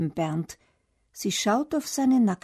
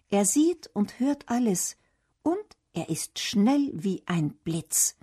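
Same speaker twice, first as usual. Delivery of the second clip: ordinary delivery, nothing marked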